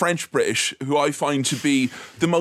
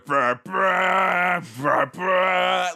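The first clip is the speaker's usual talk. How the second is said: imitated orchestra hits; 3 semitones higher, much slower than usual.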